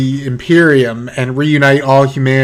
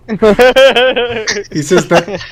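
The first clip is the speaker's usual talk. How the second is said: slow, higher words through real laughter; laughing; 8 semitones higher, much slower than usual. Real, not acted. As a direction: try to speak while cracking up. GM laughing mid-line.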